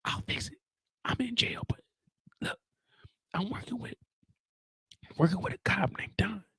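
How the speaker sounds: urgent hushed whisper. Retold or imitated. Drew pleading with Diana from jail, whispering. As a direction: whisper fast, trying to stop her.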